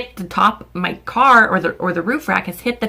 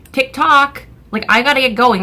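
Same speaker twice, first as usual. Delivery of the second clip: raised, louder impatient muttered-thought quote; 5 semitones higher, 4 dB louder than usual. Retold or imitated. Waiting to pay while Gavin sits in the car, impatient. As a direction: act out itching to leave.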